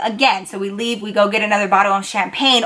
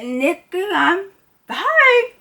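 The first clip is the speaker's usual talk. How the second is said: put-on high, slurred drunk insistence; drunk slurring; 7 semitones higher, much slower than usual. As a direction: play the drunk friend swearing she's fine.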